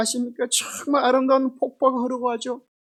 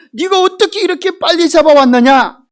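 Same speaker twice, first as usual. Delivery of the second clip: put-on louder, astonished old father; amazed father; 4 semitones higher, 8 dB louder than usual. Blind father to Jacob, loudly amazed at his speed. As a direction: marvel at how fast he came back.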